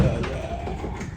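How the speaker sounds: breathy whispered yeah. Real, not acted. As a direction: drop it to a whisper. Repeating yeah, then whispering.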